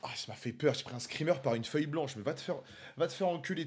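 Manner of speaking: ordinary delivery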